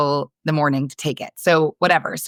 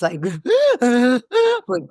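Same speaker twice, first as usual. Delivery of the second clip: laugh burst; 7 semitones higher than usual. Real, not acted. Laughing through the sob story.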